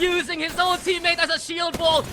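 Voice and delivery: shouted, rising play-by-play; hype esports caster. Real, not acted. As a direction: build to a hype yell. Caster hype on final fight.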